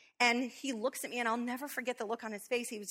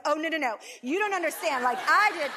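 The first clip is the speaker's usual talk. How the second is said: imagined angry retort; 6 semitones higher, 8 dB louder than usual. Retold or imitated. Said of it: "I'm gonna be like".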